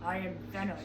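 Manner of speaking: low, soft, lightly voiced Venom; a hint of a growling symbiote; Venom impression. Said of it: "who does that look like".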